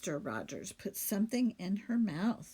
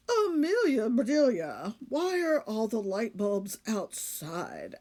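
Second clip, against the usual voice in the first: puzzled husband voice; 3 dB louder than usual. Acted. Mister Rogers character voice.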